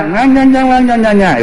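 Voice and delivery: put-on very high, singsong baby gibberish; high-pitched mock baby talk; babbling baby. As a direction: imitate an infant chattering nonsense. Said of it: "Y el niño habla".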